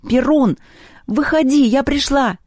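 loud, high-pitched summons; full-voice yell. A narrator's reading. Люба summons Перун to come out, full-voice yell. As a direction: call out at the top of your voice. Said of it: "рявкнула во весь голос".

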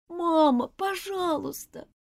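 put-on high, slow begging child; pleading. A narrator's reading. Girl begs her mother not to send her away, pleading. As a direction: beg mother desperately. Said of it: "взмолилась девочка".

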